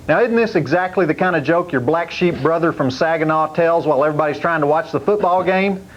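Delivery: normal talking voice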